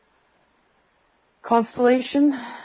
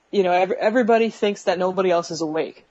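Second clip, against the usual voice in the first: ordinary delivery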